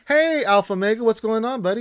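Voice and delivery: high, loud, cheerful hello to a viewer; excited greeting. Real, not acted.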